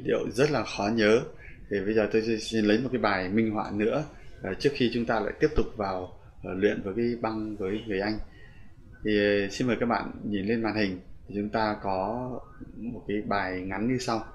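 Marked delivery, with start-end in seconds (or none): none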